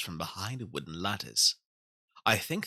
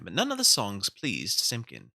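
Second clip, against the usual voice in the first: ordinary delivery, nothing marked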